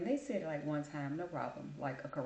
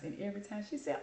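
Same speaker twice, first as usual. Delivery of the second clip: ordinary talk, nothing marked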